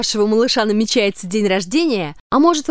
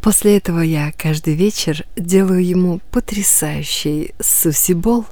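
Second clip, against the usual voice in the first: low, seductive, half whispered; sultry low voice; 6 semitones lower than usual. Acted. Sultry woman in ad. (низкий соблазнительный голос)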